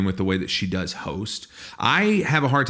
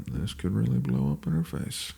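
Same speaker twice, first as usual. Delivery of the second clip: worried aside; 2 semitones lower, 6 dB quieter than usual. Retold or imitated. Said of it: "the whole time I kept going".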